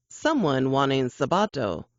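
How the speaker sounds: usual speaking voice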